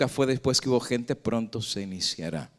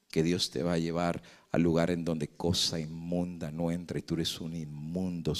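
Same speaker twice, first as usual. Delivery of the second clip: lower, softer taunting devil; quiet, menacing devil murmuring sins; devil's accusing voice; 6 semitones lower, 6 dB quieter than usual. Retold or imitated. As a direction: whisper accusations in the ear like the devil. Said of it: "Y nos va a decir".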